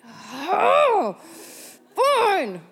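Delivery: big high, loud groan of surrender; exasperated giving in. Retold or imitated. Woman finally yielding to God's nagging, exasperated. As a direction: give in with a hammed-up huff.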